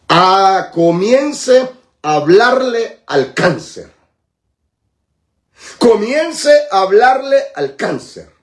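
slow, drawn-out preaching command, swelling in pitch; measured, ringing pulpit exhortation; exhorting preacher. Real, not acted. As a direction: urge the congregation with long, weighted words. Urges listeners during healing sermon.

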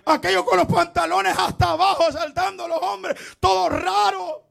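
high, loud preacher's shout; fiery preaching. Acted. Preacher denouncing the church show, fiery shouting. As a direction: rail against the jumping congregation.

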